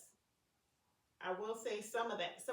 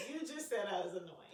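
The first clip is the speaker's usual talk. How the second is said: normal talking voice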